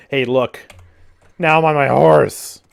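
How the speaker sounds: loud, drawn-out announcement; mock triumphant. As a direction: show it off loudly. Demonstrates suddenly being mounted.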